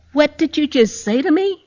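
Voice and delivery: retold incredulous question, pitched high; high-pitched, affronted disbelief, as retold; stunned disbelief. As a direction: re-enact being stunned by a phone call. Retold shock at doctor's phone call.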